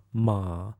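MA dips low and soft. Shown as a demonstration. Teacher demonstrates Chinese sounds, low dipping tone. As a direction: sink MA to the bottom of the voice. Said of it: "Let's try one more time".

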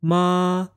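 MA held high and level. Shown as a demonstration. Teacher demonstrates Chinese sounds, high level tone. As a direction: say MA high, steady and drawn out. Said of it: "Let's try one more time".

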